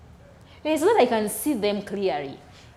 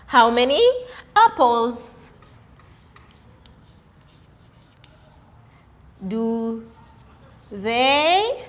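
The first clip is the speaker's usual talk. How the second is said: reading the question aloud; slower than usual. Shown as a demonstration. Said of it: "They ask us".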